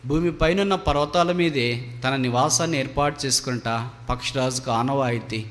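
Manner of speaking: usual speaking voice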